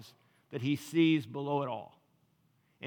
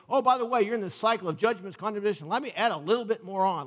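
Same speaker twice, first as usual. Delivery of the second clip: preachy condemnation; 6 semitones higher, 4 dB louder, faster than usual. Retold or imitated.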